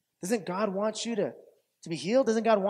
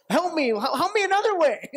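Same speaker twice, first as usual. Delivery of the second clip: high, loud demanding cry; exasperated plea; 10 semitones higher, 5 dB louder than usual. Acted. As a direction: mock-desperate demand for help. Acts out demanding different help.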